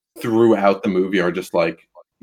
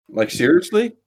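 high, disbelieving question; incredulous; 7 semitones higher than usual. Real, not acted. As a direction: ask it like you can't believe it. Incredulous at character's choice.

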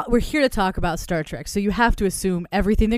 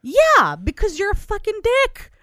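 angry yelling; 12 semitones higher, 3 dB louder, slower than usual. Real, not acted. Angry at spoiler.

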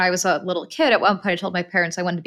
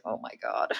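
whispered; 11 semitones lower, 13 dB quieter than usual. Retold or imitated. Shocked whisper at dead dog.

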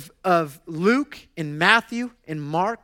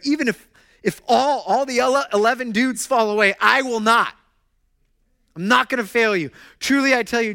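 higher and louder, insisting; bold declaration; 7 semitones higher, 6 dB louder than usual. Retold or imitated. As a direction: vow it loudly, with conviction. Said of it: "Peter declared".

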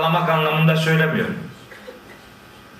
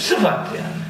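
ordinary talk

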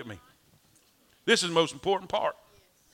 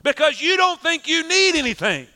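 impassioned preaching; 7 semitones higher, 5 dB louder than usual. Acted. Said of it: "And Jesus is saying".

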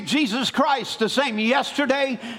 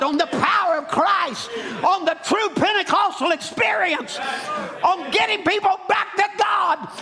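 preaching at full force; 6 semitones higher than usual. Acted. Preacher to congregation, calling them back to Christ, full force preaching. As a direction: rise up and preach it out to the church.

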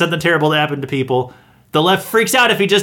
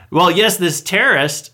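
normal talking voice

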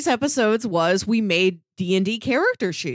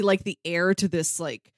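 usual speaking voice

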